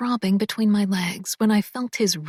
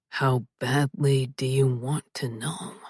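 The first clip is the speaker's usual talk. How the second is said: low, husky male voice, whispered; lower, intimate man's voice; male love interest voice; 8 semitones lower than usual. Acted. Male lead teasing.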